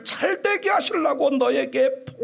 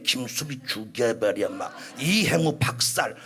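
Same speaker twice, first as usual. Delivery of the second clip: mock sermon notes; 5 dB quieter than usual. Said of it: "이렇게 설교한 사람이 있겠어요?".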